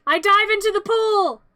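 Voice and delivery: ordinary talk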